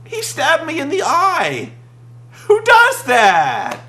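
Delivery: hammed-up indignation; mock indignant Pope. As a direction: complain in outraged disbelief. Imitating Pope's outrage.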